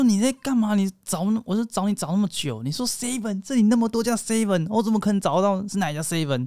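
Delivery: high-pitched mock scolding boyfriend; a hammed-up irritated guy on a scooter; angry boyfriend. Boyfriend snapping at girlfriend outside 7-Eleven, mock angry and high. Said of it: "他说".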